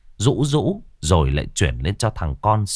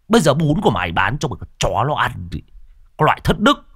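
market quarrel; 5 semitones higher, faster than usual. Acted. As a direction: tear into her, jabbing the corn at her face. Vendor berates woman, corn in face.

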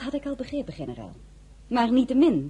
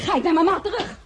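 indignant outburst; 12 semitones higher, 5 dB louder than usual. Acted. Threatening to leave.

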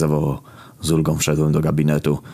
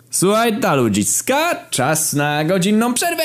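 much higher, slow, shouted announcement; doctor shouting; slower than usual. Retold or imitated. Doctor announces an hour break to the patients, shouting. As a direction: bellow the news of the break to the waiting room. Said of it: "Tym razem krzyknął".

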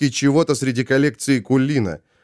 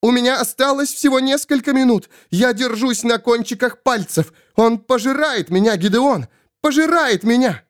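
high-pitched panicked plea; frantic high entreaty; desperate terror; 10 semitones higher than usual. Acted. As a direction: cry for rescue, voice pitched way up. Svol being devoured, begs Gideon.

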